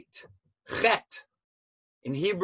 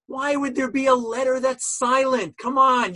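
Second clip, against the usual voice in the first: higher-pitched, indignant student objection; incredulous protest; 7 semitones higher than usual. Acted. Voices a student's protest at the lesson.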